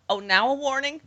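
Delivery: high incredulous sarcasm; sarcastic. Real, not acted. Sarcastic outrage at Musk.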